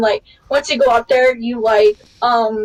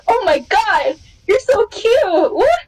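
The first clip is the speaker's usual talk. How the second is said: high, slow, drawn-out gush; mock gushing idol; 7 semitones higher, slower than usual. Idols gush at a foreign fan, high and drawn-out. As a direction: coo it like a starstruck idol.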